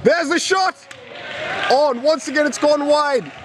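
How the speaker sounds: high shout as the shot flies; hammed-up commentator excitement; excited call. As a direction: call the shot with a jump in pitch.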